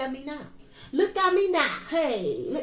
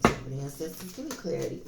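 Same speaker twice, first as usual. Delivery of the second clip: much lower and softer, reverent; prayerful invocation; 10 semitones lower, 5 dB quieter than usual. Real, not acted. Tarot reader invoking ancestors before a draw, hushed prayer. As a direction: call on the ancestors quietly.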